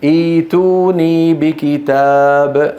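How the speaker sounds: إيتوني بكتاب slowly, every long vowel held on an even pitch; madd al-badal. Shown as a demonstration. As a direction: recite إيتوني بكتاب drawn out with a long first ee. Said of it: "أيضًا أصلها إئتوني". (تلاوة «إيتوني بكتاب» بمدّ بطيء)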